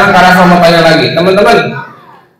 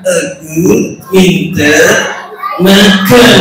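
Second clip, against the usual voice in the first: slow, muffled puppet copying; laboured puppet attempt, word by word; puppet's botched echo; 3 semitones lower, much slower than usual. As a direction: drag it out in a lower puppet voice. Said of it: "saya bilang".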